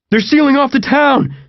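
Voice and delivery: high, loud yell over the music; screamed. A narrator's reading. John shouting over John Fogerty in the car, screamed. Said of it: "John screamed over John Fogerty".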